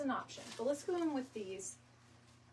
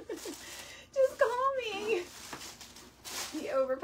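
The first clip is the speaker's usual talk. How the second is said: much higher, very slow, laughing through the words; 12 semitones higher, 3 dB louder, slower than usual. Real, not acted. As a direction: stretch the joke out while cracking up. Jokes, then repeats it laughing.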